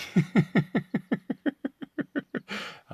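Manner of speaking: long, high giggling laugh; drawn-out high cackle; scornful laugh. Real, not acted. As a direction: laugh at length at a ridiculous idea. Laughs at idea of making money.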